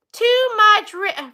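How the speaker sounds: ordinary talk, nothing marked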